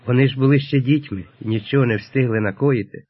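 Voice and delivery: usual speaking voice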